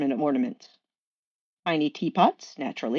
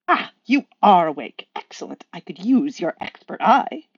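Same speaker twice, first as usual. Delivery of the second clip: louder, fussy angel voice; pleased and a little prim; Aziraphale's voice; 5 dB louder than usual. Acted. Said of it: "I will do voices".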